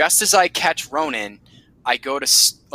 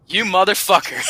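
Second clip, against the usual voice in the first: higher, teasing mock shout; mock-accusing cry; 7 semitones higher than usual. Kneeling at Steve's grave, mock-accusing shout. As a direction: cry it out at an old friend, about to laugh. Addresses dead friend at his grave.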